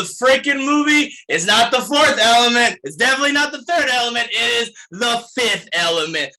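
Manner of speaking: emphatic shout. Real, not acted. Ranting about the movie title.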